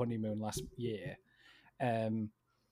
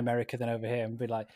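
normal talking voice

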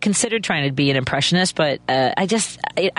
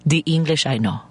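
put-on Mexico City accent. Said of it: "She's like".

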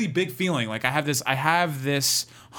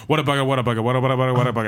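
louder, clipped British exclamations; Jeremy Irons impression; 3 semitones lower, 4 dB louder, much faster than usual. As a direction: splutter like a flustered Brit. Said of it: "Jeremy Irons going".

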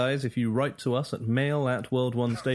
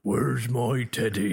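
silly game character; slower than usual. Retold or imitated. Quoting Fable game voices.

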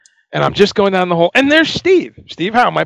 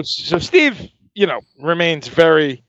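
ordinary delivery

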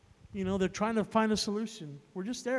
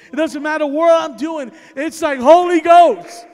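fired-up evangelist in full cry; impassioned preaching; 5 semitones higher, 12 dB louder than usual. Real, not acted. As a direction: preach it louder and higher, ending in a full shout. Preaches at rising force.

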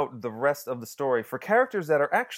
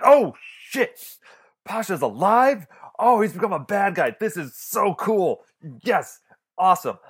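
high, loud, thrilled exclamations; re-live the thrill, loud and high with stretched words; excited outburst; 7 semitones higher, 7 dB louder than usual. Retold or imitated. Film fan reliving the moment Pasha turns up alive, thrilled. Re-enacts thrilled reaction while watching.

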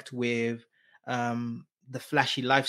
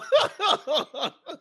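high short laugh; giggle; 4 dB louder than usual. Real, not acted. Laughing at his own French.

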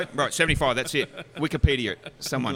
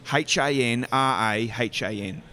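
put-on spelling out a name slowly in an American accent; letter by letter, slow, American accent for the phone; slower than usual. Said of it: "actually use an American accent".

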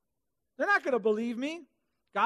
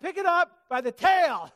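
higher, much louder order to pick it up by the tail; booming command; 8 semitones higher, 11 dB louder than usual. Acted. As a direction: give the order with full force. God's command, then Moses' disbelief.

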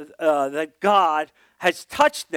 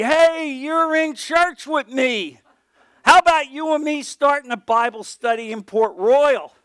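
eager invitation; 9 semitones higher, 5 dB louder than usual. Retold or imitated. Churchman's invitation, acted.

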